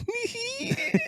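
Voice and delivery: high, squeaky imitated giggle; giggling. Bored coworker starting to laugh before his joke, high giggling. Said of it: "He's, like, giggling, going".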